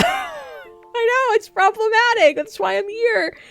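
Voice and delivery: high, squealing defiance; high-pitched defiant. Retold or imitated. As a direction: gleefully own the guilty pleasure. Defiant retort to critics.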